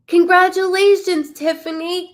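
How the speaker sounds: gasp, then burst out high and loud; thrilled congratulations to the lucky viewer; host congratulates the drawn winner, gasping and excited. Real, not acted. Winner's name just drawn.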